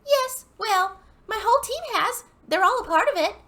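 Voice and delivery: very high, loud hun with a put-on Dallas twang; shrieky-high mock Dallas belle; mock Dallas accent. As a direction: trill it out as a hammed-up Dallas sales lady. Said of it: "In a very Dallas accent".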